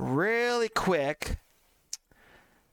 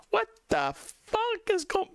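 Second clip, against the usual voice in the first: much higher, slow disbelieving cry; shocked outburst; slower than usual. Real, not acted. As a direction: gasp it out in utter disbelief. Shocked by the game screen.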